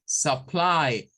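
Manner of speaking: Supply with a schwa start, suh-PLY; English supply. Shown as a demonstration. Teacher modelling Supply again. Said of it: "parece U".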